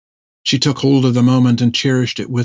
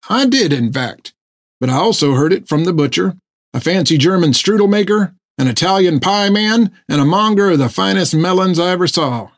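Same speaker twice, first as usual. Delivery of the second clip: higher, cheery boasting voice; eager sailor; 6 semitones higher than usual. A narrator's reading. Topper boasting to Jack about the baker's daughter, higher and cheery. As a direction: brag a little, chirpy.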